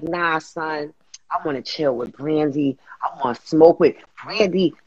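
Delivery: dropped low, laid-back voice; cool deep reply. Retold or imitated. To people calling her Moesha, a low chill brush-off. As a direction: lean back and drop the voice way down. His own reply about [name].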